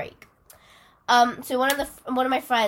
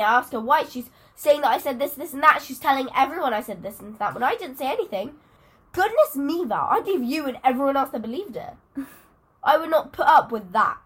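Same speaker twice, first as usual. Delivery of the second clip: normal talking voice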